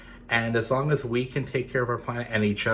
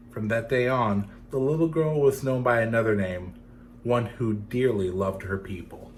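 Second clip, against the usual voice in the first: gentle storytelling read; story narration. A narrator's reading. Reading a legend to children, gentle storytelling.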